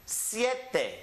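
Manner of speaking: ordinary talk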